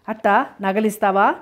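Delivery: ordinary delivery, nothing marked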